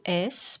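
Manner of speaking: « S » comme un sifflement sourd, sss; son S. Shown as a demonstration. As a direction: fais entendre le son sss seul pour « S ». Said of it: "pour faire le son S". (« S » prononcé comme phonème, pas « esse »)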